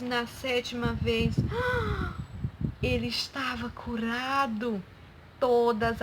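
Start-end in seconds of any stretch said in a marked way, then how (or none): 1.4-2.8 sharp, high gasp of surprise; amazed gasp. Acted. Storyteller at the seventh dive, astonished gasp. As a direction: suck in breath for the big reveal.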